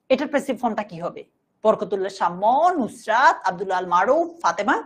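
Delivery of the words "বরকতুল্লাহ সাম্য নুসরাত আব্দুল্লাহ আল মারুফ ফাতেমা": usual speaking voice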